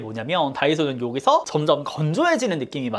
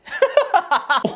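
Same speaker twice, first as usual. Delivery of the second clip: high squealing laugh; high giggle; 13 semitones higher, 3 dB louder than usual. Real, not acted. Laughing at her own pose, high giggle.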